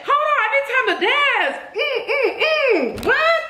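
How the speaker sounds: high, fast, laughing excitement; can't contain the joy, laugh through it; thrilled and laughing. Real, not acted. To viewers, about the medley, high laughing excitement.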